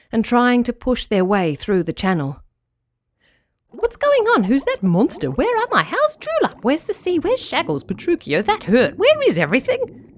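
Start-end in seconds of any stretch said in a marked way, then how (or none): 3.7-10.1 high-pitched, bewildered little fish; dazed fish piping up in panic; blopping fish voices. A narrator's reading. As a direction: fire off confused questions in squeaky fish voices. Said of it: "the fish bob blopped".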